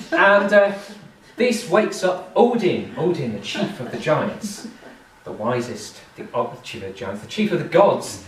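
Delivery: plain reading voice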